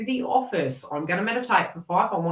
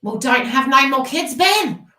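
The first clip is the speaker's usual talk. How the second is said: high, loud teasing outburst; mock scolding; 7 semitones higher, 8 dB louder than usual. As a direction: jokingly tell someone off at full pitch. Jokingly scolding absent Ben.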